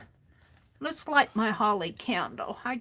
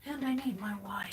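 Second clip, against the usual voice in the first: whisper; 6 dB quieter than usual. Real, not acted. Muttering to herself while crafting.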